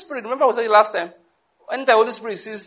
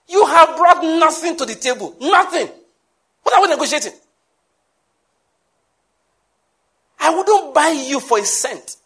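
raised, higher and louder rebuke; forceful preaching; 7 semitones higher, 5 dB louder than usual. Acted. Preacher rebuking the congregation, loud and high. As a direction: hammer the point home to the room.

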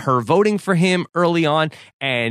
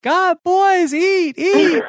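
much higher mock mothering voice; fussing mother; 14 semitones higher, much slower than usual. Host imitating Missy pushing food on the boys, high mock mother. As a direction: coax them to eat like a doting mom.

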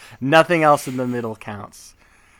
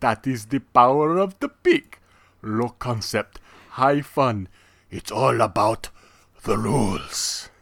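slow, theatrical vampire with a put-on accent; hammy Transylvanian vampire host; Dracula impression. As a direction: welcome them like a grand old vampire count, drawing out each phrase. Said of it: "I was doing Dracula".

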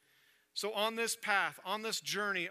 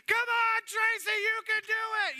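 very high, loud pleading shout; coach cheering; 7 dB louder than usual. Acted. Coach on his knees urging the runner home, high full shout. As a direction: yell it like a coach begging her on. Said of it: "cheering her on".

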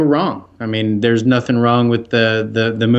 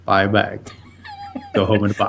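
movie line quote; 3 semitones lower, 4 dB quieter than usual. Retold or imitated. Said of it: "He just says it all the time".

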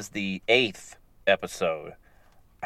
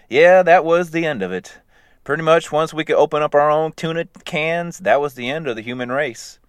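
higher, louder smug cat; 6 semitones higher, 5 dB louder than usual. Retold or imitated. Cat telling robots how humans ended, high smug. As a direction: gloat like a cat that won. Talking cat explaining takeover.

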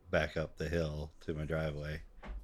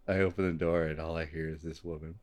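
ordinary delivery

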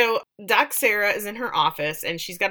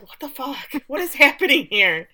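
mock shock; 7 semitones higher, 6 dB louder than usual. Sarah's shocked reaction, retold.

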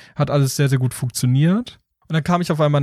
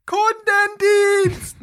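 very high, slow, drawn-out shouts; yelling into phone; much slower than usual. Retold or imitated. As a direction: scream it at the phone menu. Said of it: "sofort reingerufen".

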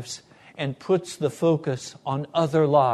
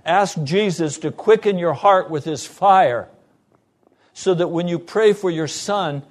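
louder, higher preaching that builds; full-force preaching; 5 semitones higher, 7 dB louder than usual. Acted. Pastor urging listeners to pray desperately, preaching hard. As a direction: press the warning on the congregation.

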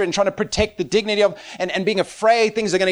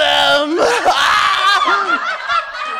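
loud, very high mock wail; hammed-up crying howl; imitated wailing; 6 dB louder than usual. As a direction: bawl like an overjoyed child. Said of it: "intense crying".

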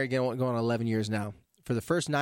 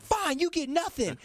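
huffy mom; 11 semitones higher, slower than usual. Retold or imitated. Mom's cold retort, quoted.

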